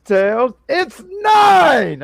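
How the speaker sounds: high, slow drawn-out shout; dramatic Nine intro. Retold or imitated. Friend hams up the villain's name reveal with a slow shout. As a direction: stretch it out like a big reveal. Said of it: "He says".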